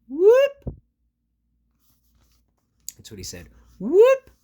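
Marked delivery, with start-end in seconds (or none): 0.1-0.8 much higher, louder rising whoop. Acted. Gerald trips, high whoop. Tripping sound. 3.8-4.3 much higher, louder rising whoop. Acted. Gerald trips, high whoop.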